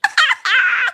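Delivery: shrill burst of laughing; high cackle. Real, not acted. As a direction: cackle up high at your own bit. Laughs after her skit.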